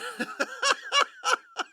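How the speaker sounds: real high-pitched cackling laugh; high cackle. Real, not acted. Host laughing at his own pick, high giddy cackle.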